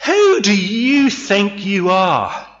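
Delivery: high, loud and slow contempt; scornful retort. Acted. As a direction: lash out at him in disgust. Wife's contemptuous retort, acted.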